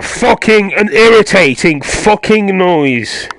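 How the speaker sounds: loud, high, drawn-out angry complaint; irritated shout. Real, not acted. Gamer maddened by a noise in the game, irritated shout. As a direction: yell each word slowly in irritation.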